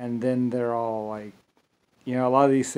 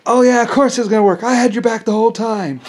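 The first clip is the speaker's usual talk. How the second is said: much higher, louder, sing-song sarcasm; mocking fake support; 10 semitones higher, 10 dB louder than usual. Imitating former doubters claiming support, sarcastic and high. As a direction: ham up their smug change of heart. Fair-weather backers mocked.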